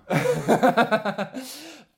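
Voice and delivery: bursting out laughing, high; real laugh at a joke; loud high laugh. Real, not acted.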